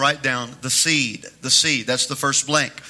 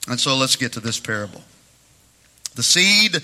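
usual speaking voice

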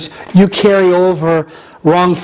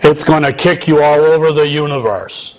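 put-on low gravelly old preacher; 4 semitones lower than usual. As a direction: growl it like an old teacher. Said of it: "He had this gravelly voice".

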